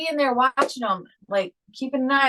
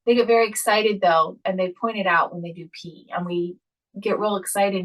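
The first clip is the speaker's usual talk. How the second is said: usual speaking voice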